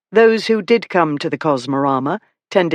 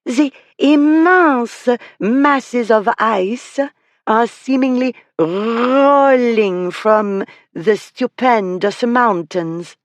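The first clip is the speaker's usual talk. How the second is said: put-on high, slow French accent with drawn-out R's; overdone French accent; 7 semitones higher, much slower than usual. A narrator's reading. The girl pretending to be a rolling glacier, overdone French accent. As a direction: roll it out grandly in hammed-up French. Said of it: "in an overdone French accent".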